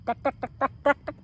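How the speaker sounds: a mild pounding jackhammer rattle; imitating a jackhammer for the class; jackhammer duck duck. A narrator's reading. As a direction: hammer out each duck. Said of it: "It makes loud banging sounds".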